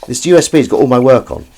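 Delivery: ordinary delivery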